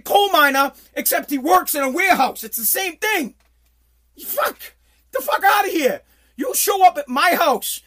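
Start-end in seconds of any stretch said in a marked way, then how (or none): 4.2-7.8 loud, higher and fed up; mock angry shout. Father yelling at daughter's boyfriend, loud mock fury. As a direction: shout him out of the house.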